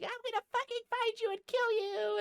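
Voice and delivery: very high-pitched mock screech; hammed-up squealing rage; freaking-out threat. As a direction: imitate someone shrieking a death threat. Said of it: "freaking the fuck out".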